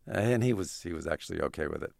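normal talking voice

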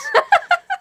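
short real laugh, very high. Real, not acted. After the omelet joke, very high real laugh.

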